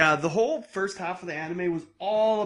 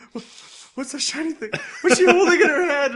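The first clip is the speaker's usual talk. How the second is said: high, laughing mock of a clueless guy; mocking Neil; 11 semitones higher, 8 dB louder than usual. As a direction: squeak it out, laughing, playing dumb. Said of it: "I love how Neil's like".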